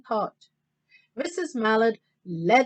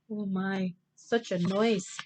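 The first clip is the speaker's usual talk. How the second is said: ordinary delivery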